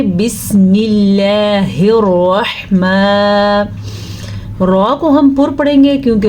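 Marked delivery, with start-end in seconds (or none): 0.0-3.6 اللہ said thin and light after بسم, slowly; thin Allah. Shown as a demonstration. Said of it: "اب باریک کیسے پڑھیں گے". (اللہ کو باریک اور آہستہ پڑھیں)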